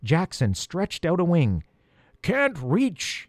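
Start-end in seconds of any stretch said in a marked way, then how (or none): 2.2-3.3 high, strained-up call at moderate volume; yelling. A narrator's reading. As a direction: call down to those below. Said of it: "he yelled".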